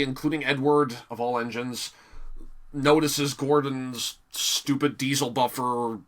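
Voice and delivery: ordinary talk, nothing marked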